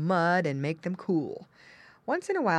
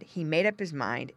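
ordinary delivery

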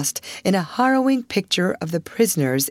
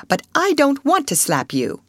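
high-pitched, held-back retort; heated but checking oneself; restrained anger; 10 semitones higher than usual. A narrator's reading. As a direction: rein in your temper as you say it. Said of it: "restraining herself".

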